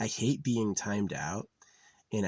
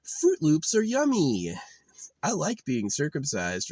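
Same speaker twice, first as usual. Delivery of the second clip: put-on high loud slowed-down babyish voice; garbled mock voice; 9 semitones higher, 5 dB louder than usual. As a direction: sound like a dopey kid. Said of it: "it came out".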